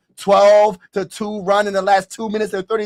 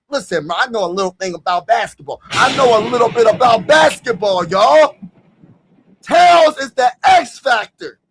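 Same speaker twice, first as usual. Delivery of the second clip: ordinary talk, nothing marked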